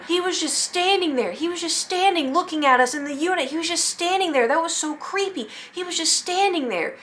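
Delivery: high, rattled retelling of a friend's panic; freaking out. Retold or imitated. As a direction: imitate her freaking out, pitch way up.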